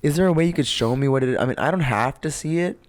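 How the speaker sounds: ordinary talk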